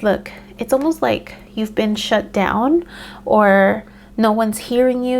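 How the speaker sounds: usual speaking voice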